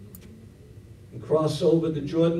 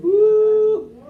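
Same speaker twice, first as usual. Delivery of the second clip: very high, very loud joyful whoop; exultant woo; 11 dB louder than usual. Real, not acted. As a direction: whoop like the Spirit just hit. Exultant shout in sermon.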